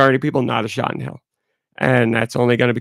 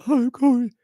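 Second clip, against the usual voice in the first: mock whiny; 12 semitones higher, faster than usual. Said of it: "Be like".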